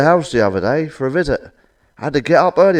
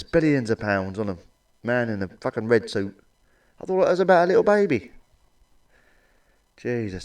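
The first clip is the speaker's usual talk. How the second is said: ordinary delivery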